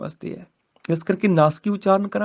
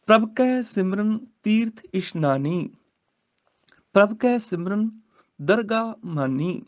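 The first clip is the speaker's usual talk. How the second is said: slow scripture recitation; 3 semitones higher, slower than usual. Shown as a demonstration. Teacher recites the verse for students, slowly. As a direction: chant the verse slowly, rising.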